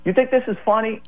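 retold indignant challenge, pitched high; cursing cop. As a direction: mimic an angry cop demanding an answer. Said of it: "starts cursing at me".

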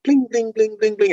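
bright, rising plucked-string imitation; plucked string sounds. Imitates the old violin's strings being plucked, rising. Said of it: "and it went".

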